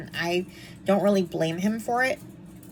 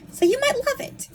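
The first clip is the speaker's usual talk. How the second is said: high, teasing sing-song mock. Real, not acted. Blind reviewer teasing viewers who loved the movie, mocking. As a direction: poke fun at the viewers in a much higher voice.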